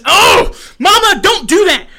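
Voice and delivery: loud, high-pitched protest to mom; wailing plea to mama; exasperated cry. Real, not acted. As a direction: yelp out a high, loud objection. Upset by mother's message live.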